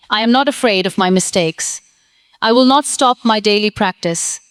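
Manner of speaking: ordinary talk, nothing marked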